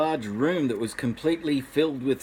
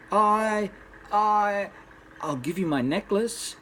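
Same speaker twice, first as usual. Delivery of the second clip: scared stammer; 7 semitones higher than usual. Acted. Frightened stammer to strange man.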